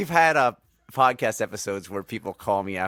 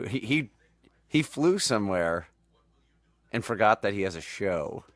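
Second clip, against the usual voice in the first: usual speaking voice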